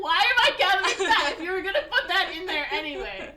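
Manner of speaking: high, loud complaint through laughter; laughing mock protest. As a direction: imitate exasperation while cracking up. Brother protests while she laughs.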